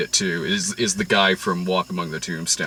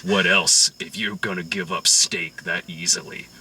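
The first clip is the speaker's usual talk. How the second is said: low, much softer gangster menace; menacing Whitey; 6 semitones lower, 5 dB quieter than usual. Retold or imitated. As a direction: quietly turn the compliment into a threat. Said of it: "And he's like".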